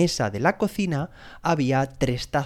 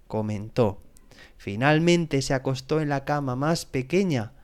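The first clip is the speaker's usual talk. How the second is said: ordinary delivery, nothing marked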